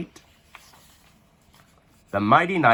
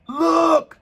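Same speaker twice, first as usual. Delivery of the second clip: high, loud alarmed cry; shouted; 5 dB louder than usual. A narrator's reading. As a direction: point at the winged egg. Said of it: "shouted Sir Tyrone".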